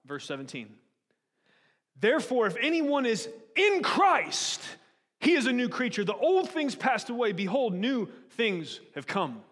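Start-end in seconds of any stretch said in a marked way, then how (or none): 2.0-9.4 preached scripture reading. Acted. Preacher reads the verse to the congregation, proclaiming loudly. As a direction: ring the verse out to the hall. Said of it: "I'm gonna read you Second Corinthians".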